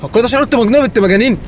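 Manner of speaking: high, loud indignant protest; outraged shout. Real, not acted. Gamer protesting to the game after insulting the family. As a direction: yell in disbelief at the joke.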